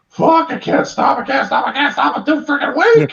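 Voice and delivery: high, loud, laughing monster mama squeal; strained mother monster. Retold or imitated. Female monster voiced, laughing.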